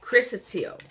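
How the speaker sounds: chrysotile repeated high and loud, as KRISS-uh-teel; correct pronunciation demo. Shown as a demonstration. Reader confirming how you say it, emphatic demo of chrysotile. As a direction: say chrysotile firmly, with a "teel" ending. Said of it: "That's how you say it".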